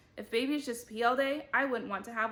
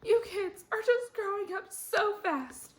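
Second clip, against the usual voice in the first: much higher, louder, wobbling tearful voice; blubbering; 12 semitones higher, 5 dB louder than usual. Acted. Teacher crying to her class about them growing up, blubbering. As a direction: sob it out like a weepy teacher. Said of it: "Missus Dole blubbered".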